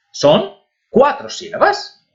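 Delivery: normal talking voice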